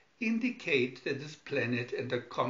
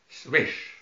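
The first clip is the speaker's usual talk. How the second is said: loud whooshing hiss; swish sound; 6 dB louder than usual. Acted. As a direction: make the airlock whoosh. Hatch sound effect.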